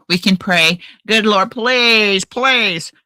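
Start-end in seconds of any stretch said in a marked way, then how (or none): none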